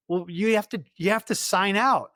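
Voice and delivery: high-pitched retold insistence; insistent. Superior scolding returned soldier, insistent.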